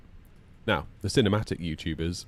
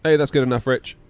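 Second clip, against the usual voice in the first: excited; 5 semitones higher, 8 dB louder than usual. Real, not acted. Cheering viewer's comment.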